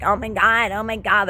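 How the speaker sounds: lightly mocking valley girl gush; a hint of a ditzy valley girl. Mocking the energy vampire as a valley girl. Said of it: "like a valley girl".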